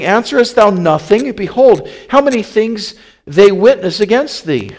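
loud, high, insistent questioning; Pilate. A narrator's reading. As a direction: press the silent prisoner for an answer. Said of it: "Pilate asked him again".

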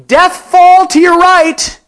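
loud, high, drawn-out call; shouted warning. Acted. Climber warns the blind man behind him, shouting. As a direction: holler it back down the mountain. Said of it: "who would shout back".

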